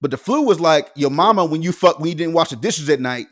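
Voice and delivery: ordinary talk, nothing marked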